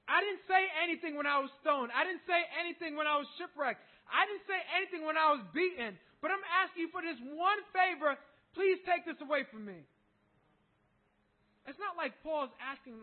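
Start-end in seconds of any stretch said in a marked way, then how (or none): 0.1-9.9 louder, pleading, building to a cry; Paul pleading loudly with God; impassioned plea. Acted. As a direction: beg God with rising force. Paul's prayer, louder plea.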